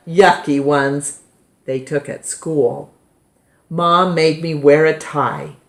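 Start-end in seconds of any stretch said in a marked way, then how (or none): none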